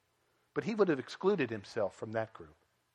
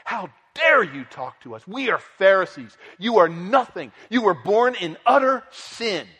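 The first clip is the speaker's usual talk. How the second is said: mad Pharisees; 5 semitones higher, 8 dB louder than usual. Retold or imitated. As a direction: scold the healed man as beneath you. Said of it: "And they get so mad".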